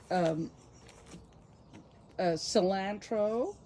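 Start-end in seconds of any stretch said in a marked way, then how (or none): 2.2-3.6 cilantro said the American way, slow and drawn out; American pronunciation. Shown as a demonstration. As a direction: put on an American cilantro, slowly. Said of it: "I can't say it in an English accent".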